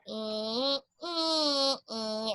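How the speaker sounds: thin "Ee" squeezed small; thinned out. Shown as a demonstration. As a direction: sing on a narrow noodle. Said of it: "thinning out the sound".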